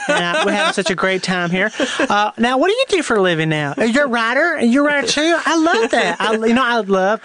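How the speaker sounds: put-on genteel effeminate Southern accent; put-on Southern gentleman, effeminate; effeminate Atlanta businessman. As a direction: introduce yourself as a camp Atlanta Southerner. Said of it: "Do an effeminate Atlanta businessman".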